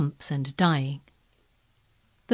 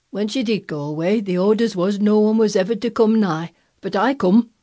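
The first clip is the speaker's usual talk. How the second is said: put-on louder, dogged old Yorkshire voice; grumpy obstinacy; 4 semitones higher, 6 dB louder than usual. A narrator's reading. Old gardener defying the orders, grumpily obstinate. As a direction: dig in stubbornly. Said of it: "with grumpy obstinacy".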